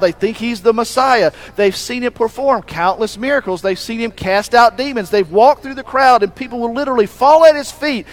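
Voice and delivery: a pastor building the congregation toward a climax; rising preaching. Acted. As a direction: preach it with mounting fervour. Preaching builds to full force.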